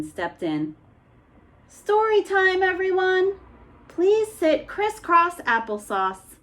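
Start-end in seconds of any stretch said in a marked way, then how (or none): 1.7-6.4 high, brighter announcing voice; cheerful librarian. Acted. Miss Watson calling story time, cheerful. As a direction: call the children over.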